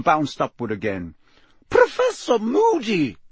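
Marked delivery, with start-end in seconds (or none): 1.7-3.2 very high shocked exclamation. A narrator's reading. McGonagall catching Moody on the staircase, shocked. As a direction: cry out the name in shock. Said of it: "said a shocked voice".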